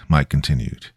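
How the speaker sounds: plain reading voice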